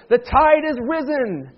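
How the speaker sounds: slow, high liturgical announcement; hammed-up formal declaration; mock proclamation. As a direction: declare it grandly like an Easter call. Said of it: "you don't say that".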